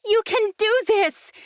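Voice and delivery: rally her with a desperate shout; cried out encouragement. Acted. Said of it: "Imogen cried".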